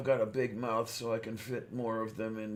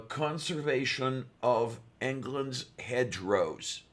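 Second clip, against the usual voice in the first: slow and deliberate, word by word; slow, careful repeat; 2 semitones higher, slower than usual. Real, not acted. Repeating the last words for a confused friend, slowly. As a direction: spell it out slowly for a listener. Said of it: "Say them slowly, please".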